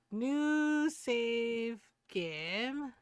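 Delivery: very slow, stretched reading; drawn out. Pretend. Reading the save menu aloud, playfully stretched. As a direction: hold each word of the menu option.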